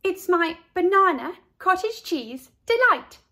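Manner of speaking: high, loud proud announcement; proud girl. A narrator's reading. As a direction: present it like a treat. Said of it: "said Carla".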